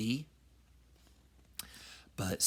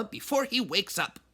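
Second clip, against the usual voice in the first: mama warning; 9 semitones higher than usual. Acted. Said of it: "ordered Mama".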